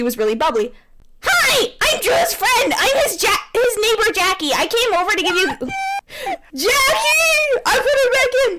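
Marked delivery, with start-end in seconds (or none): 1.2-5.5 put-on much higher, bubbly girl voice. 6.5-8.6 excited shout. Real, not acted. Excited outburst.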